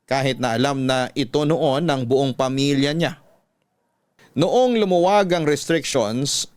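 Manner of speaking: ordinary delivery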